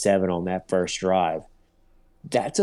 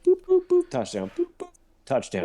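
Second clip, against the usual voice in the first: mock boop noises. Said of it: "He came out pissed".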